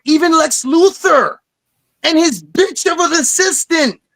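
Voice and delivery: pushed up, almost yelling about the sadness. Real, not acted. Emotional recollection, pitched way up.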